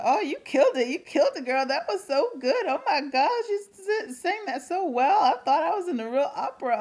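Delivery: mock excited fangirl praise; gushing praise. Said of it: "people kept coming up to me and said".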